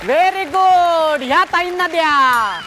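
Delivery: high, rousing show-host call; announcer. Real, not acted. Host congratulates the contestant before the audience, high rousing announcer. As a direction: work up the crowd for applause.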